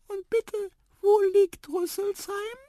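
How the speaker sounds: very high, insistent little voice; squeaky mouse. A narrator's reading. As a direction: ask in a tiny squeak. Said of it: "piepste Tiputip". (piepsig fragende Maus)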